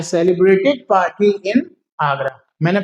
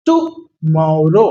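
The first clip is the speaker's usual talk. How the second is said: Tomorrow stretched out very slowly for the class; slow pronunciation demo; 4 semitones lower, much slower than usual. Shown as a demonstration. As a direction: spell tomorrow out in slow motion. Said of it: "मैं धीरे आपको बोल के समझा रहा हूं".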